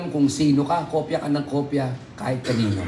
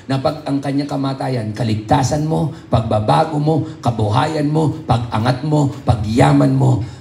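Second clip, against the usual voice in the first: preaching build-up; 5 dB louder than usual. Real, not acted. Preaching climax list.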